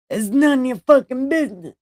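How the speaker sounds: high, fast comeback; mock snappy retort. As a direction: fire it back in one quick burst.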